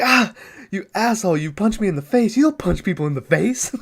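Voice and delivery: high-pitched mock protest; mock whiny outrage. Voicing the punched hippie's outrage.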